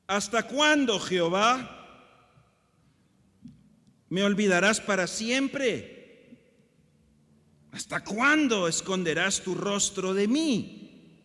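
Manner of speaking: loud, high, slow psalm lament; dramatic scripture reading. Acted. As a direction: read the lament as a cry to heaven. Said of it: "Voy a leer Reina Valera".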